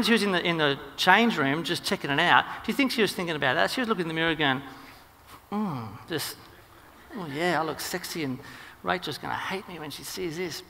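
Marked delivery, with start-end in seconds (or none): 5.5-10.6 soft, private acted musing; smug self-admiration. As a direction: murmur it to yourself, pleased, as the wife at the mirror. Wife admires herself in mirror.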